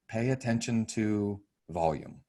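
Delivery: ordinary talk, nothing marked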